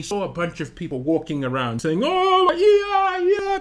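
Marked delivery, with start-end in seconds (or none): 2.0-3.6 high-pitched wail of commotion; making a big fuss. Retold or imitated. Imitating people walking around in Malawi, making a big fuss. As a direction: imitate a crowd in a flap, voices way up. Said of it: "making a big fuss".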